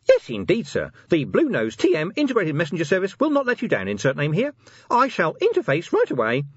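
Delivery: high eager little voice; keen tiny imp; enthusiastic imp. A narrator's reading. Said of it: "despair to enthusiasm".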